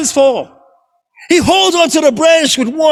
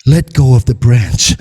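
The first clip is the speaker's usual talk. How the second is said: much lower, solemn divine voice; voice of God; 13 semitones lower than usual. Acted. God calling down to Oba, much lower and solemn. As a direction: speak down from the heavens, calm and low. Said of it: "It is God".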